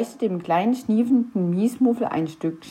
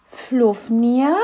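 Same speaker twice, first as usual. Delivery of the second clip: interested; 4 semitones higher, 4 dB louder than usual. A narrator's reading. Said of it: "fragte Knöterchen interessiert".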